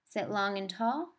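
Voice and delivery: ordinary talk, nothing marked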